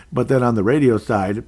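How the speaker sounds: normal talking voice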